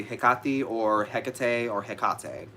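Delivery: three forms of Hecate: heh-KAH-tee, HEK-uh-tay, heh-KAH-tay; interchangeable pronunciations. Shown as a demonstration. Witch listing forms she uses interchangeably, varied pronunciations. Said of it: "I'll usually say interchangeably".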